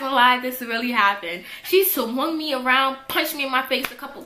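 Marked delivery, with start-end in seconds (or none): none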